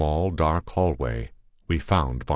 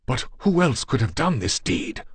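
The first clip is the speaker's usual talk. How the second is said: much higher, furious outcry; in a fury; 9 semitones higher than usual. A narrator's reading. Demart to the guests over the body, furious. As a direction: hurl the question back in rage. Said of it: "exclaimed Demart in a fury".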